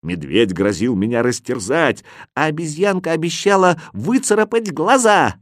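ordinary delivery

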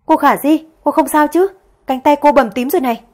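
anxious cry of concern; worried. Acted. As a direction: rush in worried for her mistress. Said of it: "sắc mặt lo lắng".

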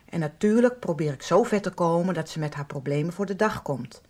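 ordinary talk, nothing marked

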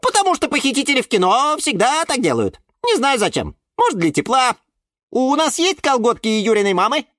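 high, eager explainer; squeaky violet. A narrator's reading. Фиалка объясняет про кино. (тонкий голосок, увлечённо)

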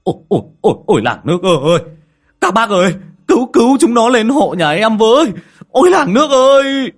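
high, loud desperate cries; terrified father-in-law calling the neighbours; panicked shouting for help. Acted. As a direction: yell for the whole village to help. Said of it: "vội vàng gào lên".

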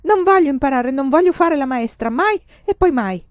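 high, loud string of refusals; furious yell. A narrator's reading. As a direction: shout each refusal with mounting defiance. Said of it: "urlò Laura". (urlo rabbioso)

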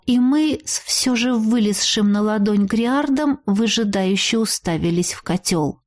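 usual speaking voice

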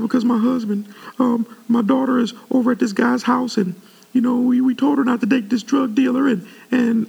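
high-pitched imitation of a pleading church lady; woman's voice. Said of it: "I don't know I'm talking like this".

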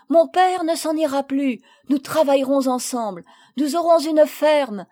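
put-on a boy bursting in to share good news; overjoyed boy. A narrator's reading. Paul telling Marguerite they stay, overjoyed.

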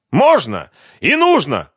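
loud, high-pitched snap back; two punched, shouted words; defiant retort. A narrator's reading. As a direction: throw it back angrily. Defiant retort in screaming quarrel.

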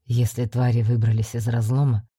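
steady low explanation; commander voice. A narrator's reading. Commander speaking to her.

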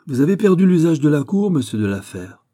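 tense, loud, held-back anger of a king; a king reining in his fury, pitched high and loud; controlled anger. A narrator's reading. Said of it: "dit-il d'une voix concentrée". (colère contenue, voix haute et forte)

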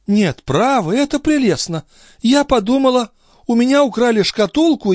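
very high, animated lady's voice; indignant countess. A narrator's reading. Countess reproaching the police chief, high and indignant. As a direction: keep a high feminine tone of mock gratitude.

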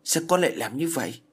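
put-on a mother snapping at her child, voice jumping up; scolding. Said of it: "Nó quát con bé".

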